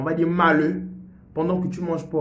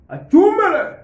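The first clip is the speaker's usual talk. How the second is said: Tchoumele yelled much higher, drawn out; 11 dB louder, slower than usual. Pretend. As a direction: shout Tchoumele way up high, imitating Kadja. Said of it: "on avance". (Tchoumele crié très aigu en imitant Kadja)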